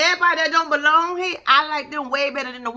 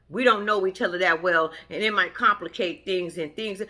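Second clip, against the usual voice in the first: mock; 7 semitones lower than usual. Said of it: "mimicking him again".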